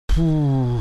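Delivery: long, loud puff of air; heavy puffed-out breath; noisy exhale. A narrator's reading. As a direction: blow the breath out noisily. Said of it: "шумом выдохнул я".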